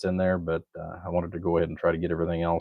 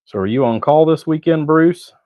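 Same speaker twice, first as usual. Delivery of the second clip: higher, louder teasing question; bright, raised, teasing ask; playful call-out; 9 semitones higher, 10 dB louder than usual. Real, not acted. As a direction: call over to Bruce with a grin.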